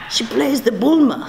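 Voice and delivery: imitating a boastful Spanish-accented dad; dad's Spanish accent. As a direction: brag about your daughter in a Spanish accent. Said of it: "when I do the accent".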